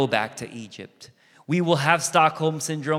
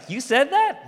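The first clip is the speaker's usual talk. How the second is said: incredulous [name]; 12 semitones higher, 3 dB louder than usual. Retold or imitated. [name] acts out his disbelief.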